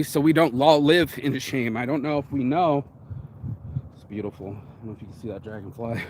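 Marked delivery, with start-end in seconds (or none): 3.9-6.0 hushed, lower aside to himself; quiet aside. Real, not acted. To viewers, about a dragonfly, hushed and low. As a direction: marvel softly at a dragonfly, voice dropped low.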